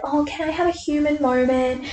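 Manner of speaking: soft, high, dainty request; a light soft imitation of Bella; mock Bella. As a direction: play a demure girlfriend asking sweetly. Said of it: "be like".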